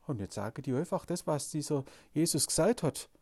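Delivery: ordinary talk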